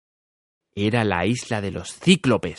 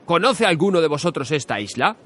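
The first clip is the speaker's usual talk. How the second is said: hero hailing his crew, raised; Ulysses calling out; 7 semitones higher than usual. Acted. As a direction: call out to the sailors like a captain. Ulises calls his sailors. (capitán que llama a sus marineros)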